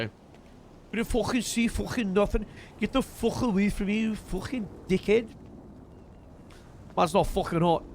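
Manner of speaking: a lightly rough London thug, swearing; rough gangster voice. Acted. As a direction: growl it like a street tough. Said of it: "This original voice of this character".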